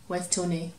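plain reading voice

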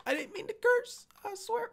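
very high falsetto plea; play-apologize in a tiny high squeak; squeaky mock apology. Gamer excusing his swearing to viewers, squeaky mock apology.

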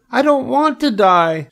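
very high piglet shriek; screamed protest. A narrator's reading. Wilbur throws himself down, high panicked scream. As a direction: squeal it out in panic. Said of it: "screamed Wilbur".

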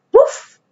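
very high playful woof; dog bark. Acted. Mirchi barking at Mummy.

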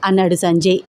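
ordinary delivery

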